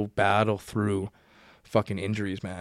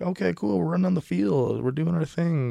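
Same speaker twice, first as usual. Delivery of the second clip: higher, casual imitation of going along; mock easygoing inner voice, pitched up; nonchalant self-talk; 7 semitones higher than usual. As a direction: breezily voice going through the motions. Retells his nonchalant self-talk on field.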